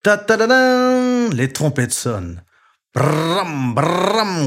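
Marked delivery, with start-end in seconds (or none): 0.0-1.3 loud, slow sung fanfare; trumpet fanfare. A narrator's reading. Trumpets imitated. 2.9-4.5 a light drum bang sound; drum roll. A narrator's reading.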